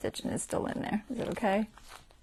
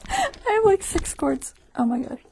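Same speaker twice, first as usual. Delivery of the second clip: exasperated laugh; 13 semitones higher, 8 dB louder than usual. Real, not acted. Laughing at too many cords.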